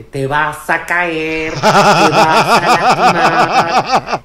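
long, drawn-out holler; mother's warning shout. Acted. Clairvoyant mother calling to her distant kid, long warning shout. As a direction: stretch every word to carry two blocks. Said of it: "le pega el grito".

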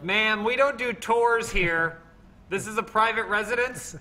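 a stuffy resident turning away a tourist; mock homeowner. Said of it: "They're like".

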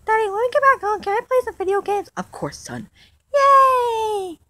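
put-on lightly high childish voice, eager; child dino voice. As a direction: ask like an excited little kid.

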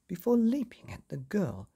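ordinary delivery, nothing marked

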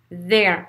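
"There" with a voiced th; buzzing th. Shown as a demonstration. Teacher drilling the vibrating th, "There" with a buzzed start. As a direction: say "There" starting on a Z-like buzz between the teeth. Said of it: "mais vibrant".

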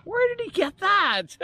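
high, slow baffled mom voice; a high, drawn-out question from a puzzled mom; puzzled mother. Retold or imitated. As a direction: play the mother wondering aloud. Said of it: "his mother says".

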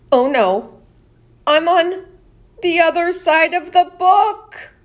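high, loud storybook panic; hammed-up fright. Knight realises he is next to the ogre, loud hammed-up fear. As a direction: act scared for the kids.

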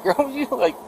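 high, loud mocking laugh through words; laughing at her. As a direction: laugh in her face, retold. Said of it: "And I laughed at her".

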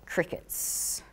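whispered deadpan "crickets" to the silent room; dry joke murmured under the breath; deadpan aside. Acted. Presenter teasing a silent audience, whispered deadpan. No one answers her question.

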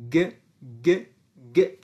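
گ as a short isolated hard G; hard G sound. Shown as a demonstration. Teacher giving the letter's sound, isolated hard G. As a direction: say گ alone, crisply separated.

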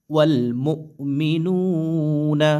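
drawn-out melodic tajweed chant; slow tilawah voice, held vowels; slow Quran recitation. Shown as a demonstration. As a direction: recite each syllable long and sung. Teacher recites the joined word.

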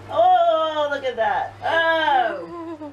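loud, high alarmed cry; shocked fearful exclamations; frightened cry. Real, not acted. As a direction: cry out at the sight. Sees spider, cries out.

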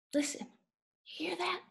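soft, hushed call to listen. Acted. Child asking listener to hear the silence, hushed. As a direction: drop the volume right down.

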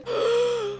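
high startled gasp; sharp gasp. Real, not acted. Player startled by the monster, sharp high gasp. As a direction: gasp in sudden fright.

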